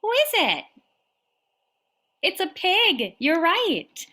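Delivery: high, bright storytime voice; reading to a toddler. Acted. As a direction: read it like to a toddler. Reading to little kids.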